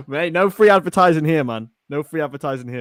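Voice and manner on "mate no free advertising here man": loud, laughing half-shout; loud protest. Real, not acted. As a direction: call it out loudly over a laugh. Repeats the line, first time louder.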